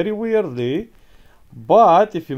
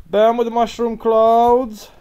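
hype shout; 8 semitones higher, 5 dB louder, slower than usual. Pretend.

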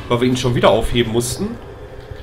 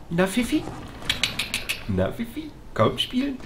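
much higher, soft sing-song; coaxing a pet; 11 semitones higher, 6 dB quieter than usual. Real, not acted. Player coaxing the dog Fifi, high soft baby-talk.

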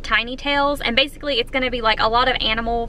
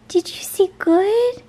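much higher, slower cooing; 8 semitones higher, slower than usual. Real, not acted. Owner repeating to her hamster in even higher baby talk.

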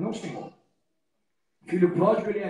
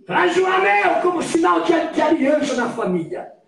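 high, booming order; shouted command; 7 semitones higher, 13 dB louder than usual. Retold or imitated. Father ordering the ring brought for his son, shouted command. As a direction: belt it out as a joyful master's order.

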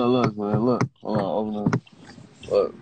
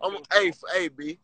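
excited; 10 semitones higher than usual. Real, not acted. Excited rant about the car.

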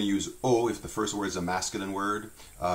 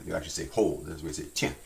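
ordinary talk

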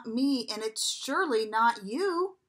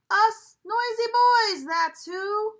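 put-on noisy boys shouting; 9 semitones higher, 10 dB louder, slower than usual. Noisy boys answer in book.

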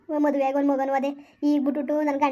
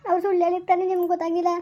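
normal talking voice

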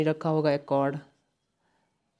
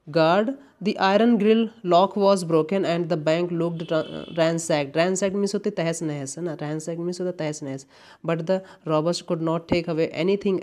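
slow exam read-out; much slower than usual. Shown as a demonstration. Teacher reads the guard's answer from the question, slow and clear. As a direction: read the guard's line slowly for the class. Reads quiz dialogue slowly.

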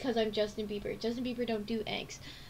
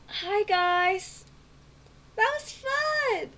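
bright sign-off; 9 semitones higher, 6 dB louder, much slower than usual. Real, not acted. Outro sign-off.

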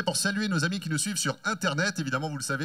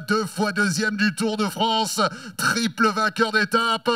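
climactic announcer name call; 7 semitones higher, much slower than usual. Real, not acted. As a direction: whip the crowd up for the team leader. Star rider climactic introduction.

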